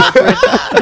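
high-pitched, loud burst of laughter. Real, not acted. Laughs at the Tesla joke.

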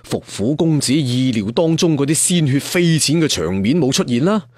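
usual speaking voice

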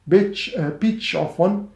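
ordinary talk

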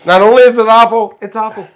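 loud, high oratorical parody; Barack Obama. Acted. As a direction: proclaim it to the crowd. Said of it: "Read the joke like Barack Obama".

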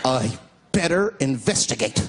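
hammed-up horror film character. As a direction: do a doomed horror-movie guy deciding.

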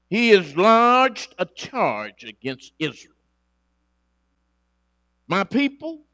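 loud, ringing proclamation of God's charge; preaching at full force. Acted. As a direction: call out to the mountains like a prophet. God's charge read aloud.

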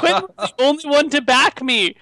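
high, dramatic wail of loss; hammed-up lament. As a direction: protest the loss melodramatically. Acted lament for dead Quinn.

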